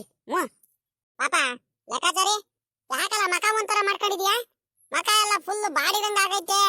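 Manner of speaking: put-on high old voice asking what happened; a village elder fussing anxiously over the boy; worried old villager. (ಆತಂಕದಿಂದ ಕೇಳುವ ಹಳ್ಳಿಯ ಹಿರಿಯನ ಎತ್ತರದ ಧ್ವನಿ)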